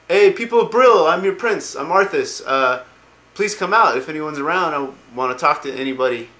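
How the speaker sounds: loud, high mock-princely call. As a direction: hail a whole empty town, acting it out. Acted prince calling out to town.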